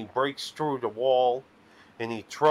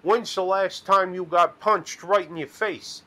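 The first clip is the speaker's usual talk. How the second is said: louder, higher challenge; mock tough-guy John Cena; 6 semitones higher, 4 dB louder than usual. Dream John Cena taunts him, hammed-up louder challenge. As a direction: bark it like a wrestler picking a fight. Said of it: "He says".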